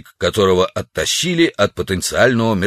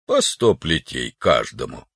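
low, listless officer's sentence; вялый приказ; 7 semitones lower, 4 dB quieter than usual. Acted. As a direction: hand down punishment in a lowered voice. Listless officer gives an order. (низкий вялый голос офицера)

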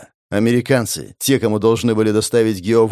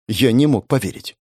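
ordinary delivery

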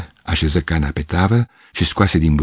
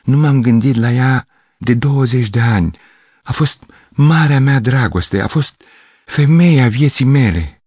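exclaiming; 6 semitones higher, 3 dB louder than usual. Acted. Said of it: "exclamă el".

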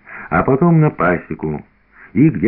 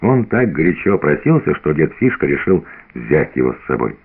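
plain reading voice

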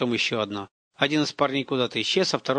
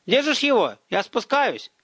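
put-on high, urgent boyish call; raised, excited check-in; shouting down; 9 semitones higher than usual. A narrator's reading. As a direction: holler it down to your brother. Boy shouts down to brother.

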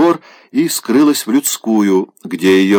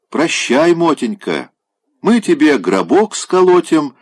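mock farewell; 7 semitones higher than usual. Mocking farewell to sick boy.

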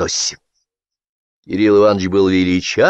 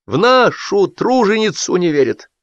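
high, slow indignant outburst; high indignant wail; boiling indignation; 11 semitones higher than usual. A narrator's reading. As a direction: seethe with hammed-up outrage. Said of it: "закипел Сапфиров".

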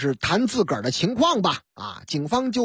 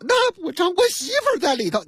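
insistent; 10 semitones higher than usual. Acted. Husband argues with guard.

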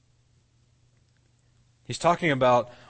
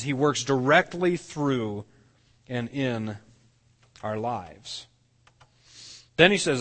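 usual speaking voice

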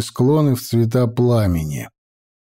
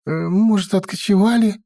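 higher timid squeak; squeaky; 7 semitones higher than usual. A narrator's reading. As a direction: squeak it out nervously. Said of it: "пискнул Варак".

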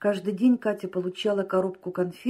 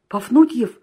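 louder, higher cry of recognition; exclaiming; 4 semitones higher, 6 dB louder than usual. A narrator's reading. Waking man recognising his agent's voice, exclaiming. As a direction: exclaim as you recognise the caller. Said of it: "воскликнул Семечкин, узнав голос".

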